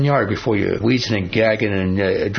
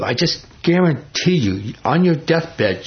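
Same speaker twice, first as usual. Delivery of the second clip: slowed right down and pitched up, leaning on every word; slow emphatic promise; 5 semitones higher, slower than usual. Real, not acted. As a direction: drag it out to drive the promise home. Preacher presses point slowly.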